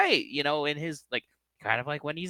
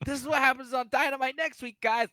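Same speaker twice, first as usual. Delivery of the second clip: high hammed-up promo read; mock hype announcer; 12 semitones higher than usual. Spoofing Excalibur plugging next week, high hype read.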